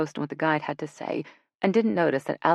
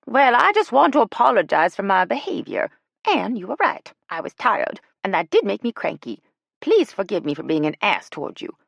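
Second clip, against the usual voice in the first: put-on higher, louder old lady, earnestly contrite; a lightly voiced grandma making amends; sincere apology in a high old voice; Ella voiced; 6 semitones higher, 6 dB louder than usual. A narrator's reading.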